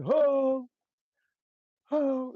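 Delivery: high ho ad-libs with a pause. Retold or imitated. Producer stacking his own ho in the booth. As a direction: call out high ho twice. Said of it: "I'm like".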